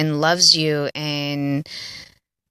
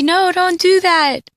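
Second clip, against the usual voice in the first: high, drawn-out pleading yell; 13 semitones higher, 4 dB louder, slower than usual. Acted. Viewer warning a movie character, yelling. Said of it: "you're yelling at the TV".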